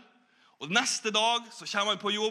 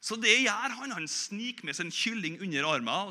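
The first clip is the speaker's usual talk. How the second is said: ordinary talk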